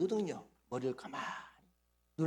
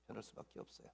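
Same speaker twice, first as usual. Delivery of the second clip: breathy hushed whisper of 변할 수밖에 없어요; 7 semitones lower, 20 dB quieter, faster than usual. Real, not acted. Pastor repeating his conviction to the congregation in a whisper. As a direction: let it fall to an intimate whisper.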